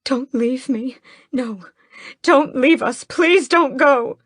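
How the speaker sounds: high, loud sobbing plea. A narrator's reading. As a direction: beg him not to die through sobs. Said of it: "sobs against his chest".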